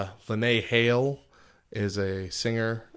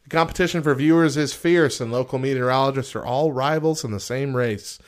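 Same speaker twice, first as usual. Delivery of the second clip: bright, louder list read; podcast host reading a top-ten list; listicle read-out; 5 semitones higher, 6 dB louder than usual. Acted. Reads listicle aloud.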